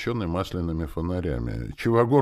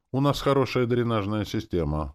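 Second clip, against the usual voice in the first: slightly higher, assured reply; confident; 7 semitones higher than usual. A narrator's reading. Deputy answering about the drainage, confident. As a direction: state it with mild assurance. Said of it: "уверенно проговорил зам".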